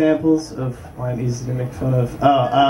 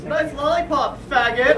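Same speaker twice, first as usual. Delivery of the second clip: high mocking jeer across the street; bratty kid taunt; 11 semitones higher than usual. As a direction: sneer it like a snotty kid.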